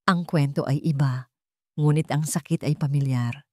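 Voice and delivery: plain reading voice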